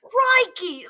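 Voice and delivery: high mock Aussie yelp; Australian accent. Trying Australian for her friend, high-pitched mock Aussie. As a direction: exclaim in cartoon Australian. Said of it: "an Australian accent".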